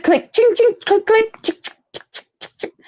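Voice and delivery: phone camera clicks. Retold or imitated. Imitating her friend's phone snapping pictures, quick clicks. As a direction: imitate the shutter going off rapidly. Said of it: "phone going click".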